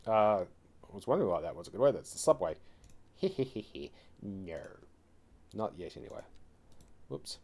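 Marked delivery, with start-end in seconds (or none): 3.2-3.9 snickering. Real, not acted. Laughs at own slip.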